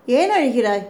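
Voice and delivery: put-on childlike. A narrator's reading. Young woman asks her tearful sister-in-law, lightly childlike. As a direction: ask with a hint of a child's innocence. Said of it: "குழந்தையைப் போல கேட்டாள்".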